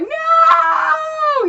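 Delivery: high, loud yelping shout; dog's panicked no. Retold or imitated. Owner imitating her dog refusing Megan's arms, loud yelp. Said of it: "he flipped out and was like".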